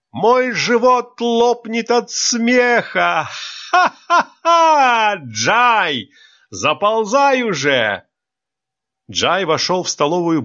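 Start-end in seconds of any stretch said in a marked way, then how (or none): 0.1-8.1 very high, slow, cackling; howling with laughter. Acted. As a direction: belly laugh breaking the words. Teacher dying of laughter. (захлёбываясь смехом, очень высоко)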